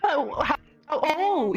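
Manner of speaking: normal talking voice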